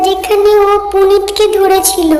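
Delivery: high, tense voice on the spot where he was caught; a frightened girl's high voice at the death spot; high breathless line. Acted. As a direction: point it out high and shaken. Chandra points out the spot.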